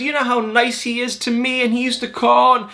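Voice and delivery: high, sustained mock-tearful voice; lightly hammed-up hysterical pleading; imitated sobbing complaint. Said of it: "then she's just sobbing".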